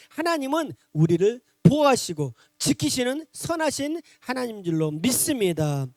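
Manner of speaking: usual speaking voice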